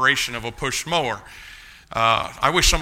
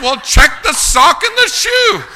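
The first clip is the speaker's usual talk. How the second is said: much higher, louder and slow; exasperated demand; 12 dB louder, slower than usual. Retold or imitated. Patient telling the doctor to find his toe, loud exasperated demand. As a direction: bark it at the doctor, high and loud.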